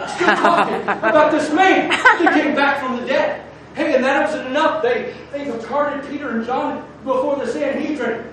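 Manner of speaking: loud, high, impassioned sermon; full-force preaching. Real, not acted.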